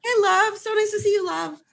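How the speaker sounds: lightly performed tender greeting; sweet greeting. Shown as a demonstration. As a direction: greet a friend sweetly and brightly. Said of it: "I love saying love".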